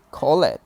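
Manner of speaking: "call it" as two syllables, a wrong "call-ed"; extra-syllable -ed mistake. Shown as a demonstration. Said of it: "we don't say".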